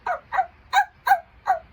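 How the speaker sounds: high, loud happy barking; excited dog barks. Acted. Dog barking happily at his family. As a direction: bark like an excited little dog. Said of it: "He got so excited, he went".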